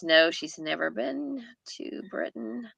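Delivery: normal talking voice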